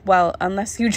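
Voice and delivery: normal talking voice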